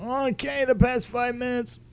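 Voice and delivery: high, slow sarcastic listener voice; mock bored listener.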